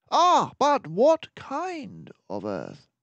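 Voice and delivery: detective nudging his friend toward the answer; knowing prompt. Acted. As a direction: draw out the hint like a patient teacher. Detective teasing a question.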